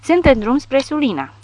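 frightened wail. A narrator's reading. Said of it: "balena urla".

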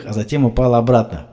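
ordinary delivery, nothing marked

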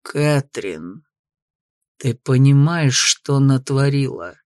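low, slow, seething through teeth; through gritted teeth. Acted. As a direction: spit it out between clenched teeth. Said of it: "Процедил он сквозь зубы".

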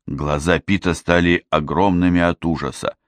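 ordinary delivery

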